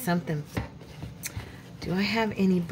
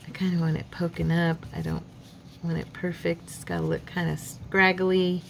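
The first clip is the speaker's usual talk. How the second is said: ordinary talk, nothing marked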